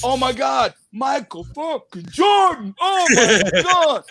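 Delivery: slow, loud, gushing mock fan shout; hammed-up drunk fan spotting a star; imitating a tipsy fan yelling at Michael; starstruck drunk fan. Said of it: "He goes".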